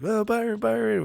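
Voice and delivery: calling out a name in a high sing-song; high teasing call. Retold or imitated. Teenage boys teasing Byron in the locker room, high sing-song call.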